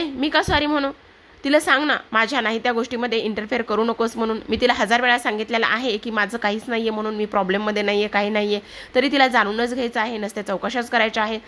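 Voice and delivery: usual speaking voice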